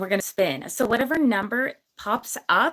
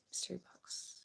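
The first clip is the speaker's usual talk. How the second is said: whispered to herself; 4 semitones lower, 23 dB quieter than usual. Real, not acted. Muttering a viewer's question to herself.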